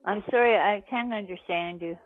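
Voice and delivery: usual speaking voice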